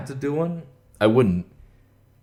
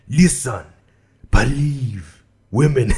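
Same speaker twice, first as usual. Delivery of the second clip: put-on voice, word by word; loosely attempted impression, in emphatic single words; 4 dB louder, slower than usual. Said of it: "do it in [name]'s voice".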